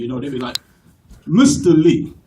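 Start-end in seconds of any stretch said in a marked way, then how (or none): 1.3-2.1 put-on lower, stately African accent; dignified African accent. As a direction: greet formally with an African accent. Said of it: "they so dignified and eloquent".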